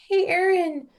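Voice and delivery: high, fake-breezy call up the stairs; forced casual call. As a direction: sound casual while panicking. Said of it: "tried my hardest to change my tone of voice".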